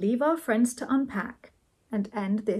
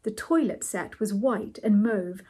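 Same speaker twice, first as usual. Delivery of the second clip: plain reading voice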